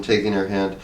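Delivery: plain reading voice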